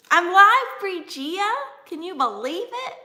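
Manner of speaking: high, loud burst of excitement; thrilled squeal settling a little; excited announcement. Real, not acted. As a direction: call it out to a friend in delighted disbelief. Tells friend she is live.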